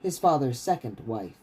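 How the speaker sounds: steady, measured reading of a fantasy novel; story narration. A narrator's reading. Author reading his novel to stream listeners, steady narration. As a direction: read the chapter out like an audiobook.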